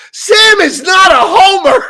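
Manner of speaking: partner's outburst, shouted high and slow; indignant retold shout. Jen correcting [name] in the retelling, indignant imitated shout. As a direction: belt it out slowly, imitating her protest.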